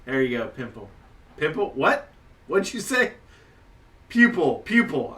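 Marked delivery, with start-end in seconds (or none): 1.4-3.1 incredulous. Real, not acted. Player teases GM's word slip. 4.1-5.1 emphatic correction. Real, not acted. Correcting the pimple slip loudly.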